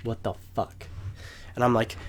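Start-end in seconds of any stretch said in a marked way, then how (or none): 0.0-0.9 soft, stressed whisper. Retold or imitated. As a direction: hush it out in panic. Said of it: "I'm stressing out".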